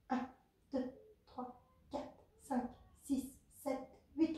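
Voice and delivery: compte les répétitions à voix basse, régulier; égrène les temps doucement, en coach; comptage des répétitions. Acted. Coach counts the exercise reps. (compter les répétitions doucement)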